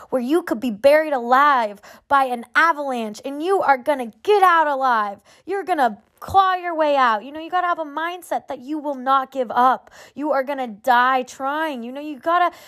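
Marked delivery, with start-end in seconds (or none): none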